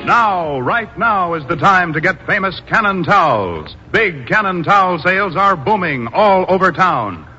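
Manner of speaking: ordinary delivery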